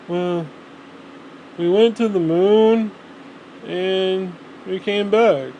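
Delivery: slow, drawn-out, bored report; lightly voiced dull astronaut; mock deadpan astronaut. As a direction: drone it out like nothing happened. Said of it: "that's not how you do a press conference".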